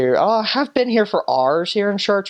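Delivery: imitating an impatient Northern Irish churchgoer; Northern Irish accent. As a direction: grumble in thick Northern Irish brogue. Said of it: "they end everything with".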